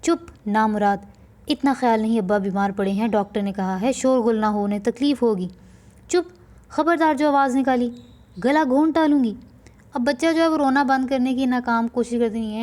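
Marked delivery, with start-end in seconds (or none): 0.0-9.3 put-on the wife silences the crying child with a loud threat; angry mother.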